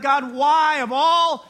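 loud, high cry up to God; acted incredulous plea. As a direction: raise it into a drawn-out, baffled appeal. Acts out his "why me" plea.

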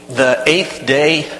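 ordinary delivery, nothing marked

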